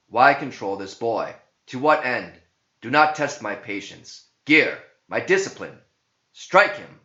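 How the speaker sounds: loud stern interrogation, rising to a high yell; loud commanding exorcist; commanding priest. Acted. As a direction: demand answers, then order the strike. Priest confronting the demon.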